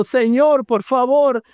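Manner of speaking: high, pleading voice close to tears; a broken sinner crying out to God; tearful pleading prayer. Acted. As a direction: beg the Lord as if weeping.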